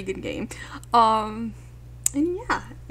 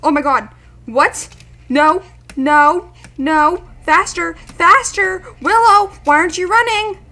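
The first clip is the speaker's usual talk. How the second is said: loud, high panicked shouting; real gamer panic, much louder and higher; panicked yelling; 7 semitones higher, 16 dB louder than usual. Real, not acted. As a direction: yell frantically at a game character to run. Panicking as Willow won't run in game.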